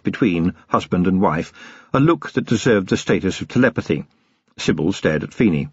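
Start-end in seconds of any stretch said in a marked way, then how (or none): none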